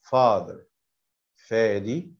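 usual speaking voice

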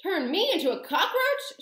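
high, loud, incredulous shout. A narrator's reading. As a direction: scoff loudly in outrage. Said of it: "shouted Mister Jenkins".

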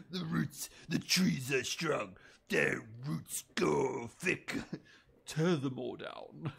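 slow, drawn-out villain impression; Saruman and orc. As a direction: drag out each word like a bad fantasy villain. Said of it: "do a chat between Saruman and an orc".

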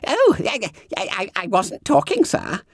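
high squeaky startled stammer; flustered fat schoolboy. A narrator's reading. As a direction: squeal it, caught out. Bunter denies talking.

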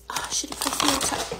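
whispered aside to herself; whispering. Real, not acted. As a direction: breathe it out under your breath. Muttering to self while opening.

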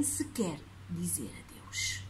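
hushed storytelling whisper. A narrator's reading. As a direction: whisper it to the listener. Whispered end of scene.

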